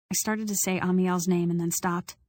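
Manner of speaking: usual speaking voice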